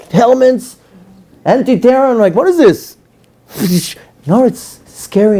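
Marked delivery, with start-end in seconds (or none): 2.3-3.0 high startled what-is-this; shocked. Retold or imitated. Shocked at soldiers guarding the shul, high startled question. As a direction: blurt it out, stunned.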